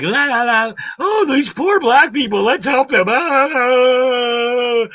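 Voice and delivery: high, loud mocking sing-song; mock a naive do-gooder in a silly high voice; hammed-up high voice of a clueless liberal; mocking Democrat. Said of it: "You're just like".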